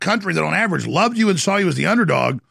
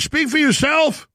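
sarcastic retort; 8 semitones higher than usual. Retold or imitated. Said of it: "And I'm like".

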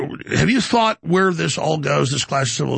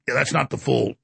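ordinary talk

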